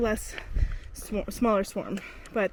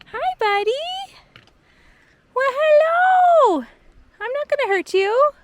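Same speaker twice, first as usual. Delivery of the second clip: talking to an animal; 10 semitones higher, 6 dB louder than usual. Real, not acted. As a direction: coo at a little critter so it won't run. Talking sweetly to a marmot.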